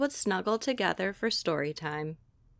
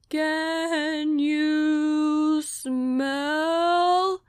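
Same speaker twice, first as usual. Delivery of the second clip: very slow, stretched sloth voice; sleepy sloth; 5 semitones higher, 3 dB louder, much slower than usual. Acted. Sloth introduces himself to Sasha, extremely slow. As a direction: let every word crawl like a sloth.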